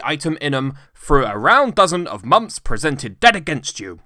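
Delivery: loud, bitter tirade; indignant rant. A narrator's reading. Scrooge ranting at his nephew about Christmas, loud indignation. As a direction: rail against every fool. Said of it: "said Scrooge indignantly".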